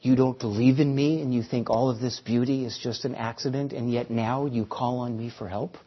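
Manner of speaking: lightly voiced God rebuking the man. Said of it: "a voice comes to him".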